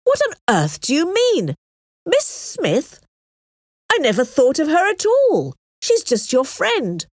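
high, incredulous protest; astonished cry. A narrator's reading. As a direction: protest in disbelief, pitch swooping high. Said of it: "cried Mister Elton".

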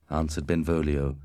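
ordinary delivery, nothing marked

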